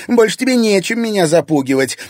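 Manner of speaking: high, gloating challenge; defiant triumph. A narrator's reading. Irina free of her father's threats, defiant triumph.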